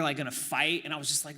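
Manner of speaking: ordinary talk, nothing marked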